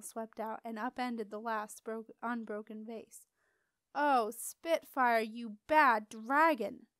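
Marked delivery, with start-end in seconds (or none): 3.9-6.9 louder, slowed-down exclaim; exasperated gasp at the pet dragon; dismayed relief. A narrator's reading. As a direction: scold the dragon, half relieved. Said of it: "gasped with a mixture of dismay and relief".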